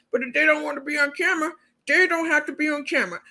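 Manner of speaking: lightly voiced, quick, sweet-talking host; high, fast mock reasonable host. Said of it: "She told us last week".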